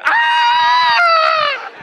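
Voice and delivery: very high, loud, sustained wail; child's scream. Retold or imitated. Daughter downstairs after falling, imitated piercing scream. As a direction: scream like a child who just fell.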